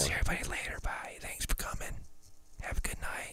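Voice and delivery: hushed whispered farewell; whispering. Real, not acted. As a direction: whisper goodbye to the listeners. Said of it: "Why are we whispering?".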